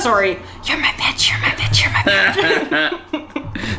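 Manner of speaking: unvoiced stage whisper, slowing down; whispered domme taunt, laughing at the end. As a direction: hiss the taunt under her breath. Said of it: "Stage whisper it".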